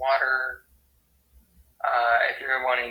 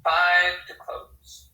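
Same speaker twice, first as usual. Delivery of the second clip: slow demonstration; 3 semitones higher, 4 dB louder, much slower than usual. Shown as a demonstration. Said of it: "the sign for sleep".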